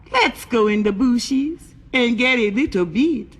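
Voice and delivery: slow, lilting invitation; Pedro coaxing. Acted. Pedro asks Rosita into the bushes, slow coaxing.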